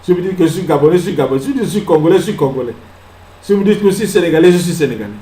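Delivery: usual speaking voice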